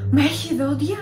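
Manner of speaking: acted outcry of disbelief; shocked cry. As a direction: cry it out, acting the startled woman. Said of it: "Τότε θέλησε να φωνάζει".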